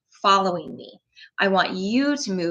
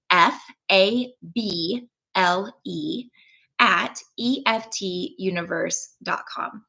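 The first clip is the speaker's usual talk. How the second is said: slow, letter-by-letter spelling; spelled out slowly; slower than usual. Shown as a demonstration. Reading her email address aloud to viewers, slow and spelled out. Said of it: "I will speak it out loud".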